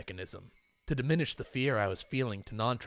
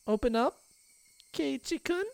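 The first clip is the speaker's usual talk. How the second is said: much higher, rising sing-song plea; high pleading; 13 semitones higher than usual. Acted. Rena begging Keiichi to open up, high and eerie. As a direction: beg sweetly and eerily.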